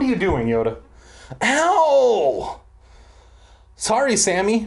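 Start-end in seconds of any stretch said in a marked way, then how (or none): 1.4-2.6 high, drawn-out yell of pain; loud yowl pitched way up; pained yelp. Real, not acted. Reader clawed by his climbing cat, pained yelp. 3.8-4.7 higher, lightly acted boy's apology; crying out apology. A narrator's reading. Brent to Sammy after the fall, lightly cried apology. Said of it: "Brent cried from close by".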